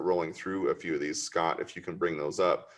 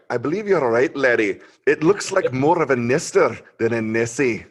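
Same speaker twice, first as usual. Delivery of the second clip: loud, higher-pitched Scottish brogue; hammed-up Scottish cartoon caption read; Scottish accent; 4 semitones higher, 7 dB louder than usual. Shown as a demonstration. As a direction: put on a big Scottish accent. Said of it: "can you do it in a Scottish accent".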